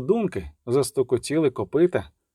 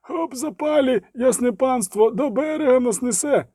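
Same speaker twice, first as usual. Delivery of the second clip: high, desperate calling; alarmed ferryman's yell, pitched very high; urgent shouting; 10 semitones higher than usual. A narrator's reading. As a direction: cry out to rally everyone. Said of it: "крикнув перевізник".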